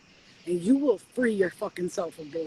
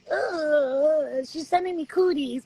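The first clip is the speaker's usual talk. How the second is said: higher, whiny disgusted demon; mock disgust; 6 semitones higher, 3 dB louder, much slower than usual. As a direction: act grossed out by cooties. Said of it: "demons disgusted by love".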